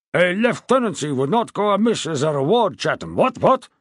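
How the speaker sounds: put-on loud, high, bluff old king; a jovial, blustering royal voice. A narrator's reading. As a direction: boom it out like a hearty old monarch. King demands a promotion.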